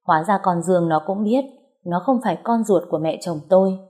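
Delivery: usual speaking voice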